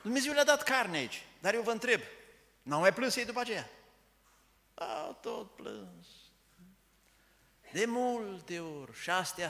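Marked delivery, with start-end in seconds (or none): 0.0-3.7 higher and louder, preaching with fire; fervent preaching. Acted. Preacher challenging the church about ungrateful crying, fervent preaching. 4.7-9.0 slow emphatic. Acted. Preacher answering his own question, slow and weighty. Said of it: "slowed emphasis after questions".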